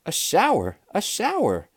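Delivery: high, gleeful mock scream; storybook children's shriek, lightly done; children's excited scream. A narrator's reading. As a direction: squeal it like excited kids. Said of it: "screamed Missus Ralph's four children".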